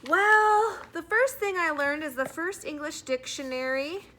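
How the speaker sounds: put-on high, loud boy launching into his report; a schoolboy blurting out his opening; boy starting a class report too loudly; nervous boy.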